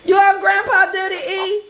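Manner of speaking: laughing hard. Real, not acted. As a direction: barely get the words out through laughter. Host cracks up teasing guest.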